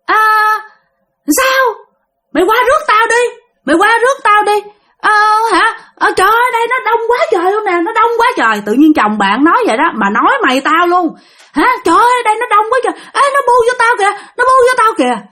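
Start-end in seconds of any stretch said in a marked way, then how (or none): 0.1-8.6 high-pitched acted voice of the husband; husband calling out. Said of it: "Nghe chồng của bạn nói vậy nè". 11.5-15.3 fast, high-pitched imitation of an overwhelmed husband; frantic calling out. Husband calls amid a swarming crowd.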